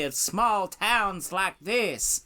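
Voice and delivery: high-pitched mock Southern drawl; Hillary Clinton's Southern accent. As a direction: lay on a hammed-up Southern US accent, far above your own pitch. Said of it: "switching back to her normal upper-class American".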